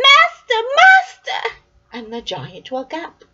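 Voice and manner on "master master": very high, loud cry; harp crying out. Acted. Magic harp calling the sleeping giant, very high and loud. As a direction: shriek for the master to wake. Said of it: "the harp cried".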